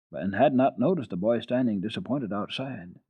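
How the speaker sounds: usual speaking voice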